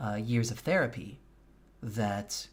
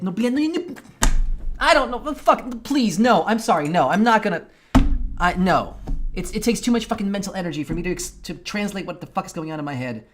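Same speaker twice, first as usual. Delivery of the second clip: loud, high, exasperated refusal; angry outburst; 7 semitones higher, 9 dB louder than usual. Real, not acted. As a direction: snap back at chat, overwhelmed and furious. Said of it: "I'm too fucking angry right now".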